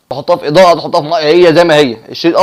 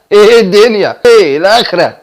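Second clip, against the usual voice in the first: mock loud query; 4 semitones higher, 5 dB louder, slower than usual. Acted casual question to coworker.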